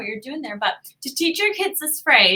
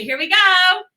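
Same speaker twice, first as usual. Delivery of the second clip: excited build-up; 6 semitones higher, 5 dB louder, slower than usual. Real, not acted. Pressing the random language button.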